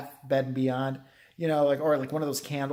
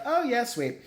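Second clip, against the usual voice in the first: lightly mimicked girl, higher; a higher mock-teen reply; imitated teenage girl; 8 semitones higher than usual. Daughter answers her mom's plan, lightly imitated girl voice. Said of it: "the little girl's like".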